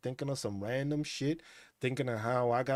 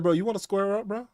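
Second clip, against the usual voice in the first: challenging the cat; 7 semitones higher, 6 dB louder than usual. Retold or imitated. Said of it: "I was like".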